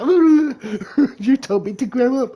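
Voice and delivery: high, loud mock-offended squeal; mocking his girlfriend's offended reaction; mock offended woman. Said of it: "she'll be like".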